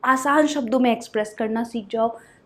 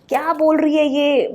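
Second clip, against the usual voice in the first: a lightly voiced, higher, louder baffled listener; mock puzzled listener; 4 semitones higher, 4 dB louder than usual. Listener confused by her speech, mildly mock baffled. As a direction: sound puzzled by her English. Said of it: "It should not come as".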